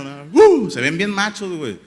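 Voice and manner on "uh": loud, high mock viking chant grunt; one burst like a big clapping fan; imitated Nordic crowd whoop. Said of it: "aplaudiendo y gritando".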